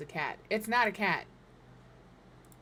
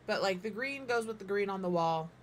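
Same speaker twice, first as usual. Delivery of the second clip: usual speaking voice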